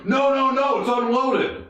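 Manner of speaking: high, urgent plea from afar; mock panicked protest. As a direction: imitate a buddy frantically calling out. Buddy protesting from a distance.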